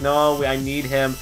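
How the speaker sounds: mock pleading. Streamer defending a mod from a ban, mock pleading. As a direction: stretch it into a hammed-up plea.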